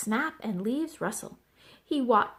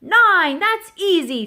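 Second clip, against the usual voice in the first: high, loud know-it-all answer; cocky shout; 8 semitones higher, 10 dB louder, slower than usual. A narrator's reading. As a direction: shout it out, showing off. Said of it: "Thistle shouted".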